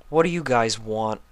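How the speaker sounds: depressed. A narrator's reading. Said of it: "he asked depressingly".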